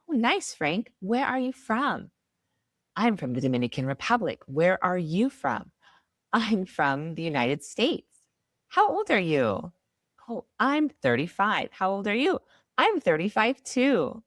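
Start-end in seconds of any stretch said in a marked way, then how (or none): none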